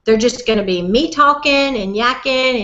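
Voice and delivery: ordinary talk, nothing marked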